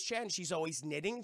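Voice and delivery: ordinary talk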